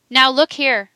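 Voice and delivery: forceful narrated shout; shouted demand. A narrator's reading. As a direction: shout at a stubborn witness to pay attention. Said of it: "shouted the solicitor".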